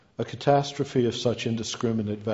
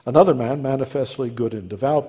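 ordinary talk

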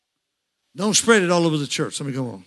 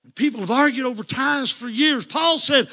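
higher, shouting preacher climax; shouted, higher sermon build; fervent preaching; 6 semitones higher than usual. Real, not acted. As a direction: preach it to the rafters. Preaching at full force.

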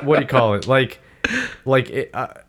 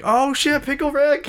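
excited fan reply. Retold or imitated. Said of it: "If they were like".

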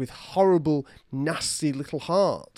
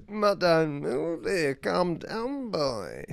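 quoting Moody; 4 semitones higher, much slower than usual. Retold or imitated. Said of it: "That really made me laugh".

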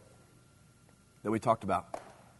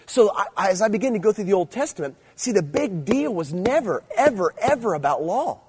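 raised, high and louder, ever stressed; emphatic preaching; 6 semitones higher, 4 dB louder than usual. Real, not acted. Preacher to teenagers, raised emphatic voice.

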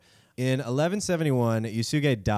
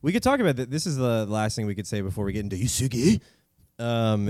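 ordinary talk, nothing marked

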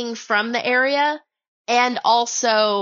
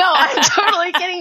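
laughing; 7 semitones higher than usual. Real, not acted. Laughs off her own joke.

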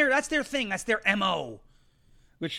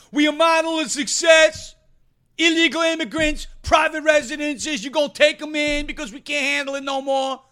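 higher, loud, shouting mayor impression; Eric Adams voice; 6 semitones higher, 6 dB louder than usual. Host mocks the mayor's claim, loud and high. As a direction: bellow it like a mouthy eighth grader. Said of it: "That's my Eric Adams voice".

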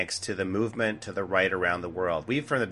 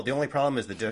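ordinary talk, nothing marked

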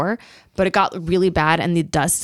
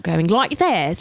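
caricatured British accent on "like this"; British accent as Americans overdo it; overdone British accent; much slower than usual. Shown as a demonstration. Comedian demonstrating what not to do, overdone British accent. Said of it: "the key to having a British accent".

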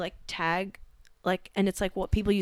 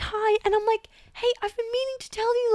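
high chirpy voice; 13 semitones higher than usual. Retold or imitated. Retold chat, high put-on voices.

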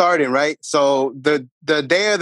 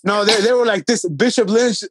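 ordinary delivery

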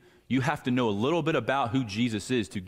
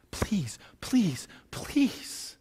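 desperate whispered begging, rising higher; whispered plea; 9 semitones higher, much slower than usual. Retold or imitated. Mother begging Jesus to heal her daughter, whispered. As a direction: breathe the plea urgently.

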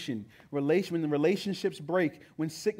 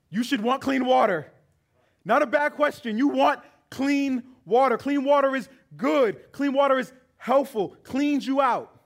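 high, loud preacher's proclamation; full-force preaching; 10 semitones higher, 7 dB louder than usual. Acted. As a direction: proclaim it to the congregation.

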